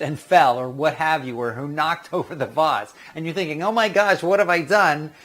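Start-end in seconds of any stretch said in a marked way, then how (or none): 3.6-5.1 higher, louder mock horror; mock dismay. Visitor thinking back on an embarrassing blunder, mock dismay. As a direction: exclaim it as if mortified.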